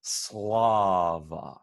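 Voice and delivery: "Slava" with a dark back-of-tongue L; sing-say "Slava" with the L pulled back; Church Slavonic Slava. Shown as a demonstration. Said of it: "So the first word".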